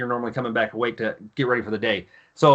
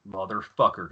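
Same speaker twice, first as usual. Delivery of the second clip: low curse; 7 semitones lower, 5 dB quieter than usual. Retold or imitated. Drill instructor's grim reaction, retold.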